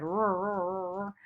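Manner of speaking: slow imitated pickup engine revving; drawn-out engine er sounds; engine rev noise. Shown as a demonstration. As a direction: stretch out the er like an engine. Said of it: "the pickup started".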